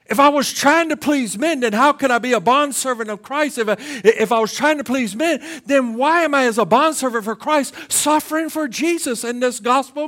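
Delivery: loud, rising preacher's cry; preaching at full force. Acted. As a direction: drive each line home from the pulpit.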